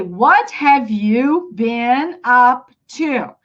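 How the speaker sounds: very slow, each word separate; slow, unlinked teaching version. Shown as a demonstration. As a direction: spell out "What have you been up to" one word at a time. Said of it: "something called linking sound".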